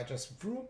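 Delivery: quick high engine vroom. Retold or imitated. Trader showing price shooting up, a high playful vroom. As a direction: make the price zoom off like a car.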